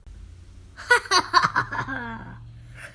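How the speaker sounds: breathy scornful laugh, no voice; mocking laugh. Principal mocking the boys' plan, a scornful laugh. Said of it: "laughed Mister Cup".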